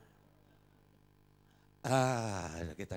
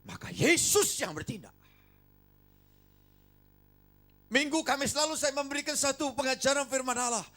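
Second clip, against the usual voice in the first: higher, louder fervent preaching; preaching at full force; 10 semitones higher, 9 dB louder than usual. Acted. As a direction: proclaim it boldly.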